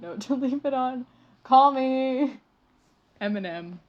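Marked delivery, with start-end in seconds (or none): 1.4-3.8 louder call. Real, not acted. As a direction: call me punched out loud.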